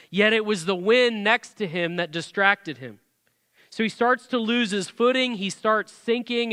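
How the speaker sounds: normal talking voice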